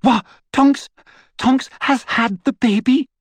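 high, shrill surprised shriek. A narrator's reading. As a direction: shriek in disbelief. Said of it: "Hermione shrieked".

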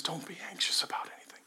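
soft, hushed and quick; loving whisper. A narrator's reading. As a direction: breathe the words gently to a child. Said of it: "the Father looks at you".

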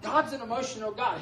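usual speaking voice

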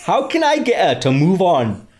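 drifting hammy Geordie voice; Geordie accent. Acted. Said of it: "should I do it in a Geordie accent?".

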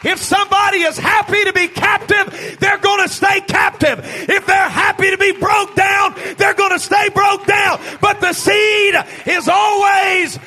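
high, loud, driving sermon shout; impassioned preacher, key words stretched and belted; full-force preaching. Real, not acted. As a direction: preach it out to the whole congregation.

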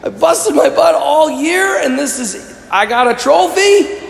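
high-pitched, incredulous complaint; hammed-up disbelief, rising to a question; mock indignation. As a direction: play the let-down winner, baffled by the prize. Imagined champion unimpressed by trophy.

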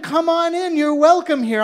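high, loud, syrupy invitation; gushing welcome. Acted. Party host gushing at the dressed-up Herschel. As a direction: lay the flattery on thick.

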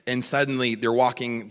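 normal talking voice

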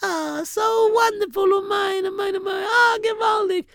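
high, gushing mother's delight. Retold or imitated. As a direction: coo over the child with joy.

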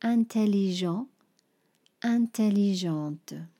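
Intelligent, intelligente said the French way, zh and nasal; French pronunciation. Shown as a demonstration. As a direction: masculine then feminine French forms. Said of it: "or intelligente for the feminine".

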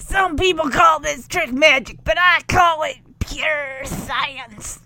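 mock mad scientist shout. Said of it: "mad scientist impression here".

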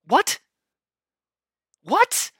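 high, rising disbelieving what; can't believe what he heard; incredulous. Real, not acted. Incredulous at the manager's comment.

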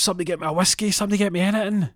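high, loud pleading cry; desperate plea. Retold or imitated. Donald shaking on the floor begging for a drink, desperate high plea. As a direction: beg like a man in withdrawal.

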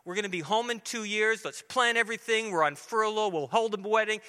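retelling a dad's cheerful reply; excited dad. Retold or imitated. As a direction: play the eager father full of plans. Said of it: "And I said".

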